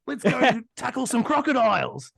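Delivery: hammed-up broad Australian accent, pitched high; Steve Irwin impression. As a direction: play the broad Australian croc hunter. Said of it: "your stereotypical Steve Irwin".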